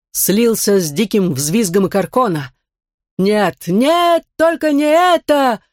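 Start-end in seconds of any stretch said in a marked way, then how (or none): 3.2-5.6 high, slow, despairing cry; desperate wail. A narrator's reading. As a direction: wail it out in horror. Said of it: "завопили оба одновременно".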